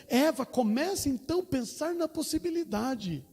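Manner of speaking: high, impassioned preaching voice; fervent preaching. Acted. Pastor retelling a testimony to the congregation, fervent high preaching. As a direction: preach it out, lifting the whole church.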